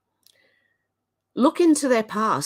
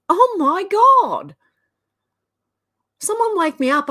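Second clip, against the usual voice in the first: exasperated disbelief; 11 semitones higher, 6 dB louder than usual. Real, not acted. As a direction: plead to be woken from this.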